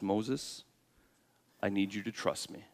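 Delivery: very soft, low, intimate voice; hushed tender appeal. Acted. Preacher voicing God asking Moses for trust, hushed and tender. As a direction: drop right down and ask softly, earnestly.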